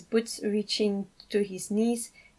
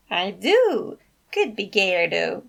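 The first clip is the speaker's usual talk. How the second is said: grinning teasing uncle; 6 dB louder, slower than usual. Acted.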